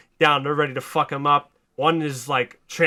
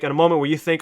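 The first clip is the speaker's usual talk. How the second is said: ordinary talk, nothing marked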